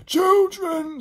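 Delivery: very high, loud shriek; horrified scream. Acted. Edmund sees the slime children, horrified scream. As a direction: scream the word in panic. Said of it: "screamed Edmund".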